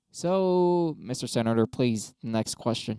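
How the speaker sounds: loud, slow formal announcer; hammed-up debate host calling next; mock moderator. Moderator calling on the senator, mock formal and loud.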